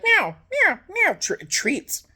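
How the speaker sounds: very high meows sliding into a slow whiny treats; cat meowing. Retold or imitated. Speaker voicing her cat Buddy begging for treats, very high meowing. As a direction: beg like a hungry cat. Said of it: "He's like".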